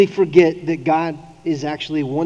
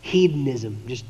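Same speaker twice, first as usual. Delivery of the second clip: ordinary talk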